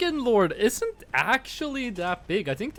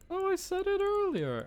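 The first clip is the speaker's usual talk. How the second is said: mocking voice; 14 semitones higher than usual. Teasing a friend in a squeaky mocking voice. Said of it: "repeats mocking line".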